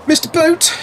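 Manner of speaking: high-pitched excited hail; excited greeting. Real, not acted. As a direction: call out the name in a high, delighted greeting. Calls out greeting to viewer.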